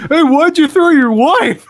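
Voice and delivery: high, slow teasing fan voice; mock fan question. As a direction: ask it like a nosy fan. Fans' question quoted.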